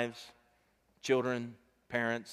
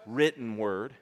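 usual speaking voice

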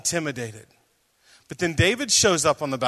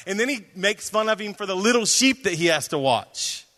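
usual speaking voice

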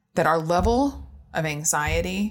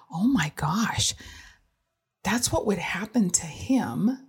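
whispered realization; slower than usual. Retold or imitated. Inner thought while watching son.

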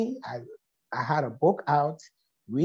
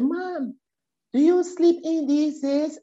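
high, surprised daughter voice; playful teen disbelief, higher; 10 semitones higher than usual. Retold or imitated. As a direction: daughter home from Denmark teasing her sleeping mom, surprised. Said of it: "she said".

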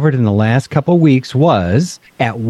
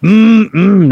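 long, high, loud mmm of pleasure; savoring "mmm"; 7 semitones higher, 5 dB louder than usual. Real, not acted. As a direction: relish the taste out loud. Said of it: "Listen up".

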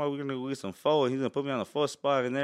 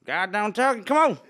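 high, loud hillbilly outburst; mock hillbilly accent, pitched way up; Hillbilly Joe; 10 semitones higher, 7 dB louder, slower than usual. Shown as a demonstration. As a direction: holler it like a hillbilly. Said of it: "that was Hillbilly Joe right there".

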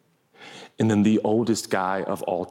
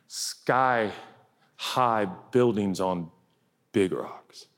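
slow emphasis; 3 semitones lower, much slower than usual. Acted. Preaching about big rocks.

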